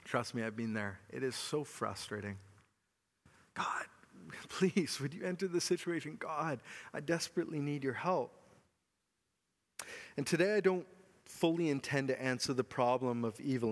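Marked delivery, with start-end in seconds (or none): 3.5-8.3 desperate whisper, half laughing; hushed, fast begging prayer. Retold or imitated. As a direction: whisper it like a private plea to God. Voicing a desperate prayer to God.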